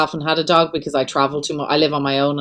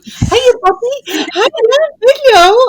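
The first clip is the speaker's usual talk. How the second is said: much higher, slow, cooing puppy talk; high sing-song pet voice; baby talk to dog; 5 dB louder, slower than usual. Retold or imitated. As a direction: coo at the dog on FaceTime. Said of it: "if people could hear me".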